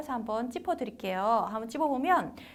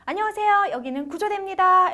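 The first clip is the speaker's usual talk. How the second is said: high, loud rescue-team greeting; answering rescue phone; 7 semitones higher, 8 dB louder than usual. Acted. Rescue worker answering a call, high and projected. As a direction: pick up the emergency line with lifted voice. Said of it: "이렇게 전화를 받았어요".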